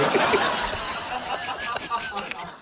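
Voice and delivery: real laugh, trailing off; burst of laughter. Real, not acted. Co-host laughing at the unfinished story, real laugh. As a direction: crack up at the teasing.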